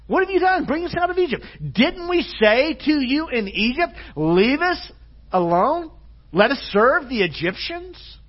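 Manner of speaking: high, loud mock indignation; sarcastic complaint. Israelites turning on Moses after rescue, high mock complaint. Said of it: "they're kinda changing their song".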